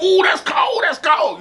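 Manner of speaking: high, hyped-up compliment; excited praise. Retold or imitated. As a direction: rave about the verse like a friend who loves it. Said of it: "He'd be like".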